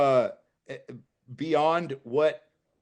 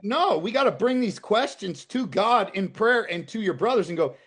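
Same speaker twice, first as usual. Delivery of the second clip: ordinary talk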